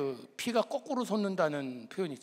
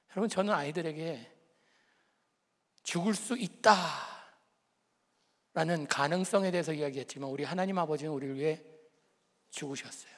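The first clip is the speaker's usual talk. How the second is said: usual speaking voice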